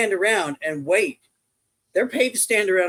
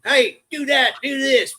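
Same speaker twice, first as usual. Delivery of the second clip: imitated bossy yell, higher pitched; mock yelling; 7 semitones higher, 3 dB louder than usual. Typical safety cop ordering workers about, mock yelling. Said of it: "typical somebody yelling".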